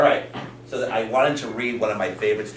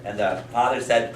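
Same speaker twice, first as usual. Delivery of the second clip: ordinary delivery, nothing marked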